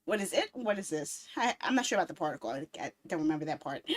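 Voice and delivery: ordinary delivery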